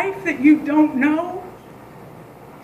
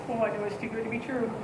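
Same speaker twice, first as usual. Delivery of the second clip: resigned aside; 4 semitones lower, 12 dB quieter, faster than usual. Retold or imitated. Inner groan at bad news.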